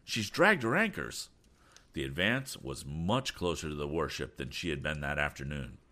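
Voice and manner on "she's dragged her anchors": high-pitched cry of alarm; urgent lookout's call; alarmed shout. A narrator's reading. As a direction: shout out the danger. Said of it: "he shouted".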